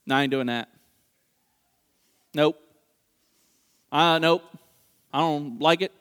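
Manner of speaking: low, deadpan mock wife refusing; comic unimpressed spouse, pausing between refusals; stubborn refusal. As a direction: dig in with short, low-pitched answers. Plays wife flatly refusing him.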